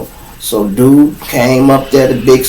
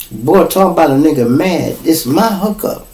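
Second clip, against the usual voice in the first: normal talking voice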